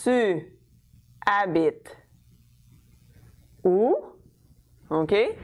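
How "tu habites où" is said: spaced words, "où" rising at the end; slow build to an upturned "où"; rising intonation. Shown as a demonstration. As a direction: pause between words, then lift "où". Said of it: "if I'm doing rising intonation".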